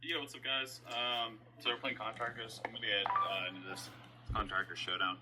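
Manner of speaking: tinny clip through phone speaker; phone speaker playback. Real, not acted. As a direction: audio played from a phone. Playing own video through phone speaker.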